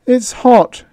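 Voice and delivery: high, strained, choking on the words; choked out. A narrator's reading. Frightened kid choking out words to Peter. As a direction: force the words out through a tight throat. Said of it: "choking out the words".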